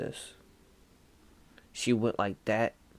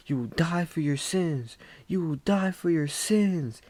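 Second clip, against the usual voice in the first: nun's curse; 7 semitones higher than usual. Retold or imitated. Nun declaring doom over the man on the cross, higher proclamation. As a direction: voice the nun condemning him, rising. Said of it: "And she said".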